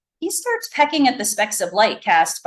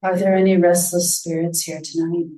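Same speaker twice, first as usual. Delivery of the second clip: low breathy voice; 3 semitones lower than usual. A narrator's reading. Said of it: "low, breathy voice".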